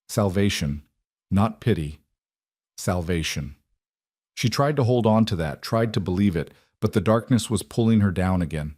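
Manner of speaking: plain reading voice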